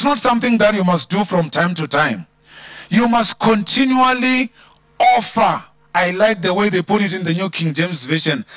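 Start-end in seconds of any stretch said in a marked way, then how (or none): 2.9-5.6 continually pushed higher with a catch of emotion; emphasis on continually. Real, not acted. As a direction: drive continually home, voice rising. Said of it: "I want to emphasize the word continuously".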